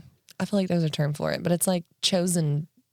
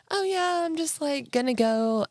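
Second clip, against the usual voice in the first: innocent daughter voice; 8 semitones higher than usual. Retold or imitated. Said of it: "I was like".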